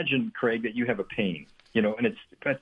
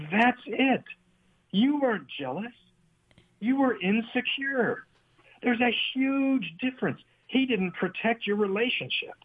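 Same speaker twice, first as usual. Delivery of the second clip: high, slow, emphatic explanation; emphatic insight; 9 semitones higher than usual. Retold or imitated. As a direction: drive the point home to Jill. Counselor explaining emphatically to Jill.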